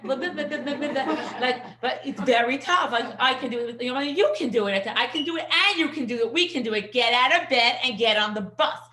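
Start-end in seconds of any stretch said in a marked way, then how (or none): 0.0-1.4 mock blah blah. Mocking the mothers' nagging. 3.2-8.8 firm, loud can-do pep talk; rally the kid out of bed; strong push. Retold or imitated. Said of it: "Like, much stronger".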